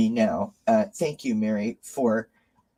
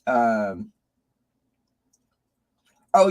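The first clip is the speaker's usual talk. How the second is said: ordinary talk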